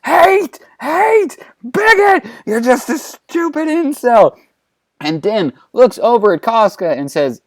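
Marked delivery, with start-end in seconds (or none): 0.0-4.4 screaming. A narrator's reading. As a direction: scream it in a shrill-high rage, word by word. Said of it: "And she starts screaming".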